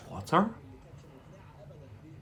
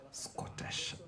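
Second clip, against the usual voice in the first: whisper; 13 dB quieter than usual. Real, not acted. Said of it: "whispered aside".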